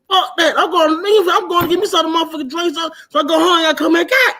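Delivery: high-pitched, worked-up refusal; retold defiance. Driver refuses her and wants a drink, defiant. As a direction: act out digging your heels in.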